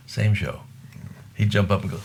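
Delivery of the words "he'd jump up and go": ordinary delivery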